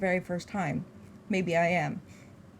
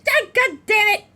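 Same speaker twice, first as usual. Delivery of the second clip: real exasperated yell, loud and high; frustrated shout; 13 semitones higher, 12 dB louder than usual. Real, not acted. Player cursing at the game, frustrated shout. Frustrated outburst during gameplay.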